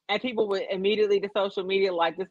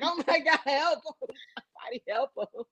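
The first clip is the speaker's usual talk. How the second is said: mock panic; 7 semitones higher, 4 dB quieter than usual. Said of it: "sound like he really was shot".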